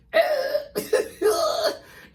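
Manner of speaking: shrieking burst of laughter; real cackling laugh; loud high cackle. Real, not acted. Big laugh after outburst.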